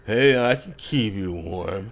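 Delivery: slow, lightly voiced creepy drunk; a hint of menace, drawn out. Drunk stranger calling out behind the bartender on the cold street, slow and creepy. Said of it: "You hear a voice behind you go".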